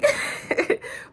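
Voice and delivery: half-laughing fake sob; laugh cracking into a cry. Real, not acted. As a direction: laugh that breaks into a whimper. Laughing while mock crying.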